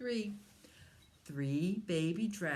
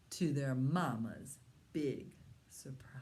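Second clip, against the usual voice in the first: plain reading voice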